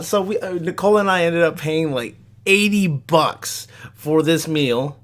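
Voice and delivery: normal talking voice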